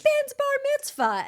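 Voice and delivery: mock cousin voice. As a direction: squeal it like the clueless cousin. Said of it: "She's like".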